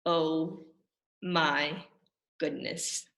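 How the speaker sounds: low, soft worn-out sigh; drained sigh. Acted. Reader wrung out after the silly sounds, low and soft. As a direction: breathe it out, spent.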